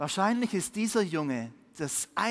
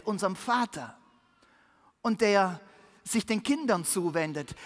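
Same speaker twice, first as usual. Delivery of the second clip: ordinary talk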